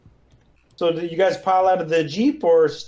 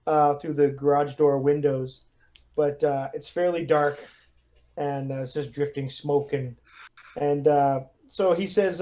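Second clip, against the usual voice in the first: ordinary delivery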